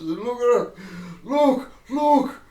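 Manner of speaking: high, loud shouts; mock shouting. Customer getting everybody's attention in a shop, loud mock shouting. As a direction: call everybody over in playful alarm. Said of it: "I shouted".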